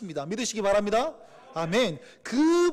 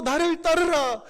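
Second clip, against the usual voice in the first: louder, higher, slow; strong call; 7 semitones higher, 5 dB louder, slower than usual. Acted. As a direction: urge them on with force. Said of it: "다시금 넘어져도". (힘차게 외치는 부르심)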